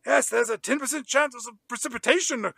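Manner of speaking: high-pitched, giddy weather recital; excited Brian impression. Brian gushing about the weather to the Australian woman, excited imitation. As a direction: rattle off the forecast with mock excitement, pitch held high. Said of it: "He is beside himself with excitement".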